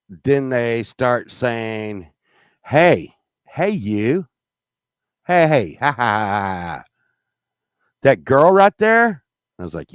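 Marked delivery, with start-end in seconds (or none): 2.6-9.2 higher mocking call with fake laugh; hammed-up taunting hey you; jeering strangers. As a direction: jeer like a rowdy drunk guy. Guys on patio calling out.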